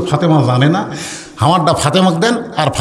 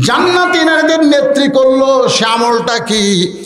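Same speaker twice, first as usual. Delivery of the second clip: high, sustained preacher's chant; melodic waz preaching; 8 semitones higher than usual. A narrator's reading. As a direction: intone the dialogue, stretching the line endings.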